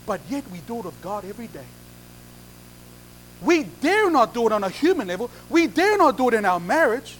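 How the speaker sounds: usual speaking voice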